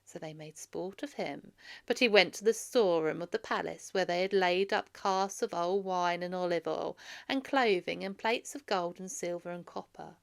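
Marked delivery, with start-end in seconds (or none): none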